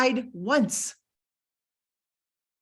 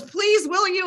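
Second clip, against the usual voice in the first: pleading daughter; 9 semitones higher, 4 dB louder than usual. Retold or imitated. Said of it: "And she goes".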